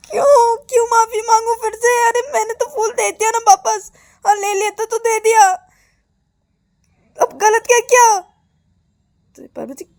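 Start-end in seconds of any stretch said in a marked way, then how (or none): 0.1-8.2 very high, loud wail of protest; outraged protest. Acted. Girl refusing to apologise twice, outraged high protest. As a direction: wail that you did nothing wrong.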